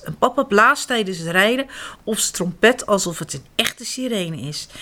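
ordinary delivery, nothing marked

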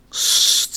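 een zacht sissend sst, zonder stem; shushing. Acted. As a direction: stil maken zodat Janneke kan slapen. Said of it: "zegt ze". (zacht sussend sst)

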